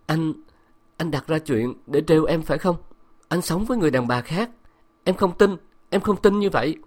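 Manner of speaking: high, shaken stammer of disbelief; stammering in shock. Acted. Wife stammers at husband's confession, shaken and high. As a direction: stumble over the words, pitch climbing in panic. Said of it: "lắp bắp".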